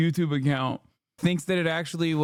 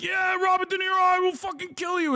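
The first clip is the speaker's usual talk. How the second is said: much higher, hammed-up cartoonish threat voice; mock enraged Trump fan; 13 semitones higher than usual. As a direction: squeak out a silly over-the-top threat. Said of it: "And they're like".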